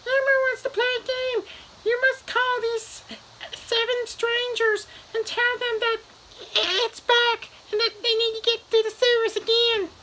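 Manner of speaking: very high falsetto puppet voice; Elmo. Retold or imitated. Puppet orders man to call seven strangers, falsetto. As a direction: squeak out orders like a children's puppet. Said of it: "And Elmo's, ... the puppet".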